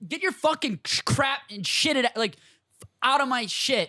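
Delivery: high-pitched mock tirade; mock angry rant. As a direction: rant it high like a fed-up roommate. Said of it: "I'll be like".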